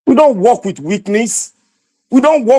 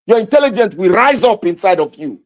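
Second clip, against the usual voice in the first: usual speaking voice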